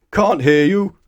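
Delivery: loud, high bellowed reply. Acted. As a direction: boom it across the counter. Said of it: "bellowed the greengrocer".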